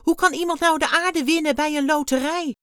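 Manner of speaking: very high, loud incredulous outburst; exclaiming. A narrator's reading. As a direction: burst out in protest. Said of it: "riep Dexter uit". (hoog en luid uitroepen)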